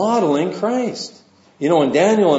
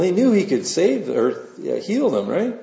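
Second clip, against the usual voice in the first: ordinary delivery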